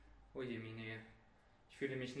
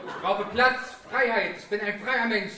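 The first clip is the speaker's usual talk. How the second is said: louder and higher, laughing through a freedom speech; loud proclamation; 5 semitones higher, 9 dB louder than usual. Acted. Boasting to his girlfriend about freedom with a loud proclamation. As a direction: declare it like a chest-thumping manifesto.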